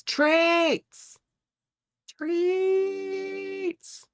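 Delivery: very high, drawn-out silly cry; high cartoon voice; squeaky cartoon shriek. Shrieking the forbidden word.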